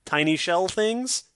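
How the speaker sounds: baffled mock agreement. Retold own baffled reaction.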